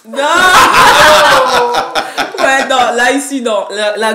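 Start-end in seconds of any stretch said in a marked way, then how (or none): 0.2-2.3 loud burst of laughter. Real, not acted. Laughs at wrong guess.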